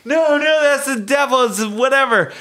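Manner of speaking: high-pitched panicked protest; hammed-up high frantic refusal; imitated freak-out. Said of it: "She freaks out".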